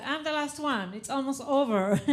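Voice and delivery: ordinary talk, nothing marked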